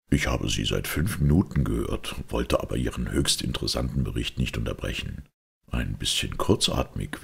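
low, measured detective with dry mockery; cool detective. Holmes confronts the listening maid, low and dryly mocking. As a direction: needle the eavesdropper in a low, composed voice.

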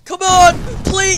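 high, very loud plea; desperate shout. Real, not acted. Player begging the game for a break, desperate shout. Desperate outburst while losing.

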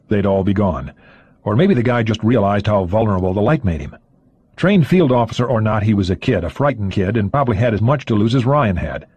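plain reading voice

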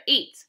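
"eight" said loud as a sample sound; long A ending. Shown as a demonstration. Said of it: "sometimes they say".

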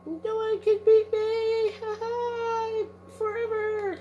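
high, loud, slow villain boast; hammed-up gloat. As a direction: crow over the losers like a cartoon villain. Gloating after winning fight.

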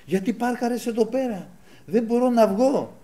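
high-pitched mock yelling, dragging the last words; retold angry neighbour. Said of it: "βγαίνει ο γείτονας και βάζει κάτι φωνές".

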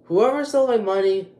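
loud, high, furious call to the thief; angry call-out. Real, not acted. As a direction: shout at the unknown thief. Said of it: "I should not be acting like this".

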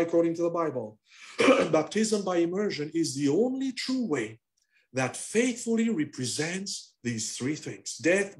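normal talking voice

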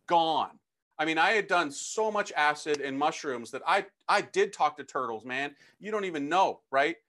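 ordinary talk, nothing marked

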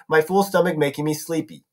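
ordinary delivery

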